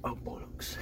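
whispered British curse. Retold or imitated. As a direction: mutter it under your breath. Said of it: "he's like".

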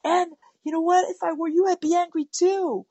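high, wide-eyed empathic exclaim, slowing on so angry; match the child's anger with big emotive sympathy; mirroring anger. Acted. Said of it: "a little bit of an actor".

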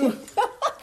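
a burst of high laughter. Real, not acted. Laughs about the racist remark. (éclat de rire aigu)

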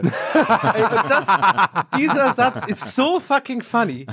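loud, high laughing delight. Real, not acted. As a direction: laugh while you say it. Laughing at the punchline.

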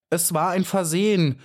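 high, eager call, a touch of relief; hopeful cry. A narrator's reading. Trapped bee calling to the spider, mildly hopeful cry. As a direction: call out, hoping to be spared. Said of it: "rief sie und zitterte vor Glück und Hoffnung".

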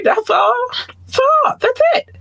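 very high little voice, short phrases; high-pitched aside. Real, not acted. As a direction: jump up into a squeaky high voice. Light squeaky brush-off to listeners.

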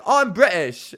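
high, loud mock British accent. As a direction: imitate a kid putting on a British accent. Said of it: "Let me hear your British accent".

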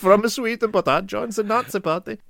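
high, laughing hammed-up Swedish; mock Swedish accent. Friends riff on Nazi extras, mock Swedish accent, laughing. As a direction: play a giggling Swedish extra. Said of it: "what your accent is there".

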